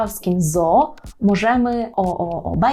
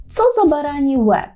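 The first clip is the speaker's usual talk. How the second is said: mock exasperated; 6 semitones higher, 3 dB louder than usual. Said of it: "можете почути фразу".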